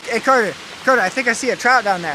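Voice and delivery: loud call across the water. Real, not acted. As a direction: call out to a friend far away. Calling friend across the creek.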